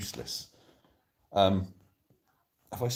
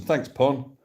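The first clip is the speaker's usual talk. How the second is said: ordinary talk, nothing marked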